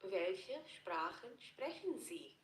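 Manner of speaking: ordinary delivery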